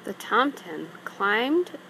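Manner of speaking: ordinary delivery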